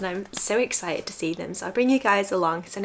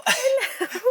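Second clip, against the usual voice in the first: squeaky pet-talk greeting; high cooing pet voice; 14 semitones higher than usual. Real, not acted. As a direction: coo at them like they're babies. Greeting the cat.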